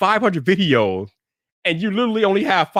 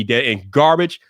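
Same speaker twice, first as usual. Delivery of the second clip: ordinary delivery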